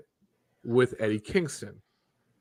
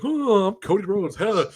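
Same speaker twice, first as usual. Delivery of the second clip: mocking higher-pitched gushing voice; Cody Rhodes; 7 semitones higher, 4 dB louder than usual. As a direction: gush it, hammed-up and mocking. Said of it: "and he's like".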